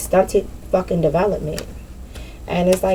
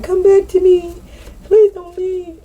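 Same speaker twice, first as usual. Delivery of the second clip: high, loud, whiny begging voice; mock pleading ex; 13 semitones higher, 4 dB louder, slower than usual. Mocking people she cut off, high whiny pleading. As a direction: beg them to come back in a squeaky mocking plea. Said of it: "They just be like".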